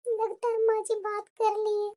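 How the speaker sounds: slow, soft murmur to oneself; quiet aside. Acted. Daughter-in-law to herself about mother-in-law's call, quiet aside. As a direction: say it under your breath, slowly.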